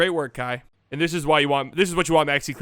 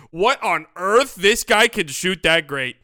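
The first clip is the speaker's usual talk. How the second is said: louder, slow, stunned praise; amazed; 2 semitones higher, 7 dB louder, much slower than usual. Retold or imitated. Fan reacting as the shot goes in, loud amazement. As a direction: marvel as the shot drops. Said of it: "he goes in and he goes".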